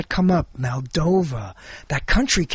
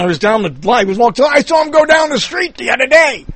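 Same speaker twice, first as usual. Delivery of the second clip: loud, hammed-up celebrity impression; exaggerated imitation of a famous actor's voice; Christopher Walken; 7 semitones higher, 5 dB louder than usual. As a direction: build to a high, halting shout. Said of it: "I can only do Christopher Walken".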